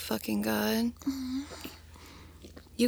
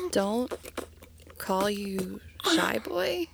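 normal talking voice

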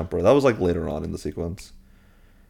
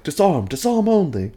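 fussy teacher call; 10 semitones higher, 4 dB louder than usual. Retold or imitated. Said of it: "Lockhart's like".

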